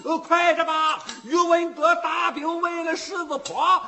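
loud, high-pitched alarm; urgent shouting. A narrator's reading. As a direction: burst in hollering the bad news. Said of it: "进门儿他就嚷上了".

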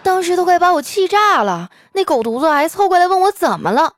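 high-pitched acted outrage; fume, still indignant at the boyfriend; exasperated.

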